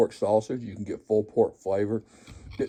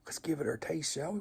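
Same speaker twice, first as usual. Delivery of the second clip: whisper; 5 semitones higher, 8 dB quieter than usual. Real, not acted. Hushed aside before tasting.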